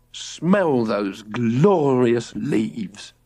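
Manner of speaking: very slow, savoring each word; enraptured dad. A narrator's reading. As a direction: drink in the air. George enraptured.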